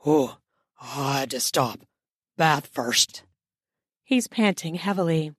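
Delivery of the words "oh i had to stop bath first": male voice. A narrator's reading.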